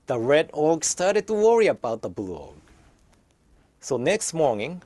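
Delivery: ordinary delivery, nothing marked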